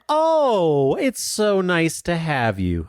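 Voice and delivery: high, drawn-out sugary greeting; over-friendly cooing welcome; gushing mock friendliness. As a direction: gush slowly at a visitor, pitched high. Retells overly friendly crowd greeting.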